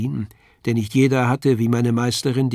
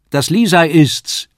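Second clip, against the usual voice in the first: higher, louder and slow announcement; excited call; 5 semitones higher, 9 dB louder, slower than usual. Acted. Paul announces Lisei to the landlady, excited and loud. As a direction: call it into the room with delight. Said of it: "rief ich".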